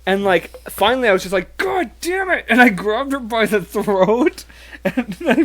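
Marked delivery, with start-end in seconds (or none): 1.6-2.5 high, strained mock cry; mock exasperation. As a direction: squeak it out fed up. Retold exasperation at being slapped.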